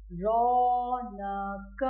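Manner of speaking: each word stretched very slowly and clearly; slow drilled words. Shown as a demonstration. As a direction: draw out every word for children to repeat. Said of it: "बार बार बोल रही हूँ". (बच्चों के लिए बहुत धीरे, साफ़ उच्चारण)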